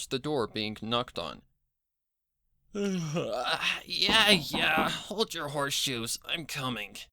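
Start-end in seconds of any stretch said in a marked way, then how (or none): 2.7-7.0 a touch of a yawn through the words. Acted. Rainbow Dash answering the knocking door, lightly yawning. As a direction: call out mid-stretch, half yawning. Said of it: "she yawned".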